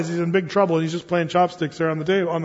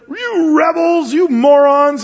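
loud, high and slow, spitting out the insults; furious outburst; 9 semitones higher, 8 dB louder, slower than usual. Retold or imitated. Moses yelling at the complaining people, furious. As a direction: rage at the grumbling crowd. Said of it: "he says to these people".

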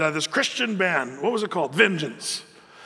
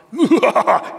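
loud, high mock-villain cackle; hammed-up evil laugh; 5 semitones higher, 8 dB louder than usual. Preacher imitating Satan as openly evil, cackling villainously.

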